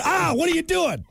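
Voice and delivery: high startled cry; shocked patient. Retold or imitated. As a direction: react as if just hit. Patient hit with hammer.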